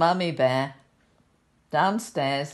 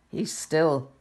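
ordinary delivery, nothing marked

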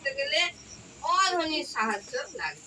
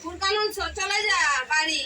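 calling out; 4 semitones higher than usual. Real, not acted. Teacher shoos away interrupting child.

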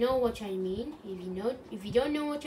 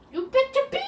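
higher and louder, a playful yell; mock yell; 8 dB louder than usual. Gamer yells a playful threat at his viewers. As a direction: bark it at the viewers in fun.

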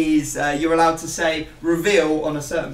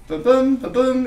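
ba-boom sound effect; 8 semitones higher, 6 dB louder than usual. Pretend. Sound effect for laundry cycle.

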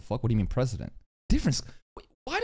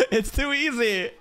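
laughing; 6 semitones higher than usual. Real, not acted. Laughing at the anime scene.

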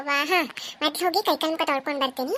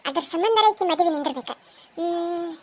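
ordinary delivery

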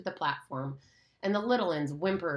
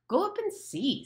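scornful jab, pitched high; high, scornful Jack; contemptuous; 12 semitones higher than usual. Acted. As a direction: sneer it at him. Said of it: "said Jack contemptuously".

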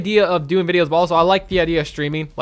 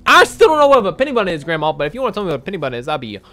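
usual speaking voice

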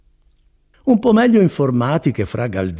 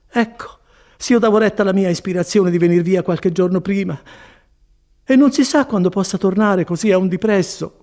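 higher-pitched regretful woman; raised, plaintive woman's voice; Agnese lamenting; 8 semitones higher, 4 dB louder than usual. A narrator's reading. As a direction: fret as the disappointed visitor.

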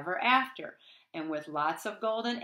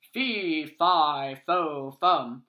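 louder, lower giant roar; giant's roar; 3 semitones lower, 7 dB louder, much slower than usual. A narrator's reading. The giant chases Jack, roaring. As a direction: roar each word. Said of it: "roared the giant".

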